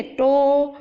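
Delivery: टो said alone with a round ओ; isolated syllable. Shown as a demonstration. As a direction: give टो on its own. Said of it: "ध्यान से सुनिए".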